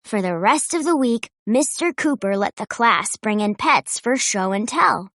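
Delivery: usual speaking voice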